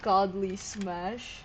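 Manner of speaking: ordinary talk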